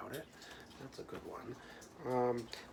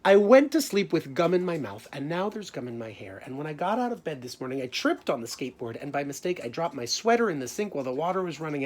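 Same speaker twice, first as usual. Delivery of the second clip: loud, lively storybook reading; reading to children; 9 dB louder than usual. Acted. Teacher reading Alexander's grumpy day, animated. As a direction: read it big and animated to the class. Reading picture book aloud.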